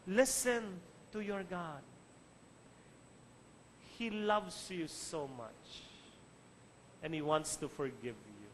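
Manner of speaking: slow, softening, hushed reassurance; tender appeal. Real, not acted. Preacher urging congregation to hear God, tenderly. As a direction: let the voice drop gently with long pauses.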